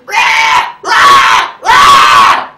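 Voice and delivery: huge roaring dinosaur screech, full force. Retold or imitated. Screeching at the mugger like a spitting dinosaur. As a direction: scream it right in his face like the Jurassic Park dinosaur. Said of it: "I screamed right in his face".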